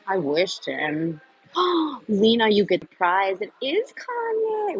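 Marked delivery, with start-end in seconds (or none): 1.5-2.0 sharp gasp. Real, not acted. Reacting to a correct answer. 3.4-4.8 excited reveal. Real, not acted. Revealing the quiz answer.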